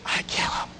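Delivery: barely voiced, hushed admission; whispered quote. Audience member admitting what she would do, hushed. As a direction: whisper it like a guilty secret. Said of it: "Somebody said it".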